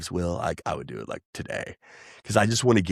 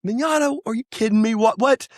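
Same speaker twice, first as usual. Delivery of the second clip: higher pitched, disbelieving; surprised reply; 11 semitones higher than usual. Retold or imitated. Retelling his reaction to the caller's praise, mock surprised. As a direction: act like you can't believe the compliment. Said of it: "And I was like".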